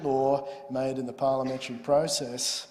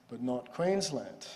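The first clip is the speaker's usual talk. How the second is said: ordinary talk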